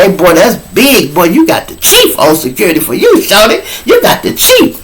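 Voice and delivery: climbing high mock praise; teasing Shorty about his chief guard. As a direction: rib him, rising to a squeal. Teasing the young inmate.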